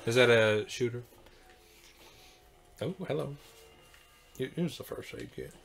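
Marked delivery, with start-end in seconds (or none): none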